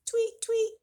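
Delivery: high loud chirping tweets; high bird call. Acted. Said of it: "What does the bird say?".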